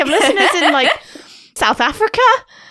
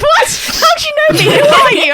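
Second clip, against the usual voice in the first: high, loud startled questions; imitating her own shock; 6 dB louder than usual. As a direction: replay the stunned reaction, high and louder. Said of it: "I was like".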